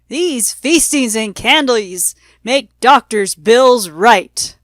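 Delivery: slow, loud parting call; loud exclaim. A narrator's reading. The doctor calling back as he leaves, slow loud exclaim. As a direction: proclaim it as you drive away. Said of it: "And I heard him exclaim".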